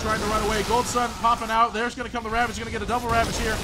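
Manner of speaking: high, loud fast game call; excited play-by-play. Acted. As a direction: call the fight like a caster. Live play-by-play in a teamfight.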